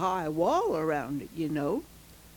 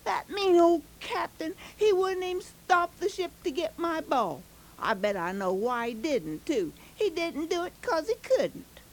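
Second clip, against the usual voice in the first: put-on high, upset child's complaint; angry boy; 7 semitones higher than usual. Furious boy complains to Joan about the captain, high and upset. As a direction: sulk like a furious little boy. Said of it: "He went back to Joan and said".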